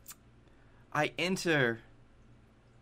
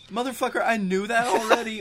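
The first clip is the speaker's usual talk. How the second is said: mock indignation; 9 semitones higher than usual. Acted indignant reply to teacher.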